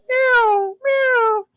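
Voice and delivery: high squeaky imitated meow; high imitated cat meow. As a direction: mimic a normal cat's meow. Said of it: "Instead of going".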